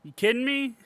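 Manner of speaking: very high disbelief; incredulous squeak. Real, not acted. Incredulous outburst.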